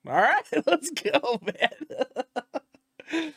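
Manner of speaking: gleeful laughing. Retold or imitated. Accepting promotion gleefully.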